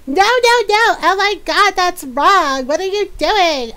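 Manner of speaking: mock upset viewer. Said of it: "they started going".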